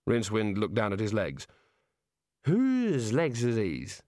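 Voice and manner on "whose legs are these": put-on drunk bewilderment.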